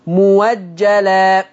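slow drawn-out recitation of موجلا with waw; Warsh reading. Shown as a demonstration. Teacher showing students the Warsh reading, slowly drawn out. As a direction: stretch موجلا slowly, hamza turned to waw. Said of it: "شوفوا الهمزة مفتوحة وقبلها ميم مضمومة".

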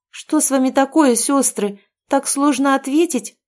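hurt that the sisters won't answer. A narrator's reading. Girl asks silent sisters, hurt and offended. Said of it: "обиженно спросила я".

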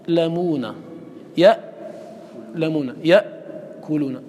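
slow يألمون and يأكلون with sound locked on the hamza; stopped hamza demo. Shown as a demonstration. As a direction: cut يألمون dead at the hamza, pause, then go on. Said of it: "هل تسمعون لها-، للس-، للهمزة صوتا؟ ينحبس".

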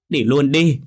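wife yells through tears, high and loud; tearful shout. A narrator's reading. Wife crying out at her returned husband, tearful shout. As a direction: hurl it at him, half sobbing. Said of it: "Thơm vừa khóc vừa đánh".

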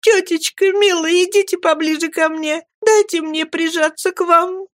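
put-on high, tearful girl's plea to her aunt; a weeping young girl's high, clinging plea; sobbing plea. A narrator's reading. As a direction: sob it out, begging to be held. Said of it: "рыдая, промолвила Эмилия".

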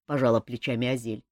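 plain reading voice

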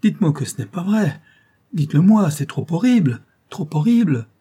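high, loud, anguished plea; desperate and appalled; horrified pleading. A narrator's reading. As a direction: beg her to deny it, voice swooping up. Maid begs, horrified by suspicion.

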